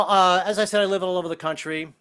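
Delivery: usual speaking voice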